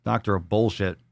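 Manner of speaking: ordinary delivery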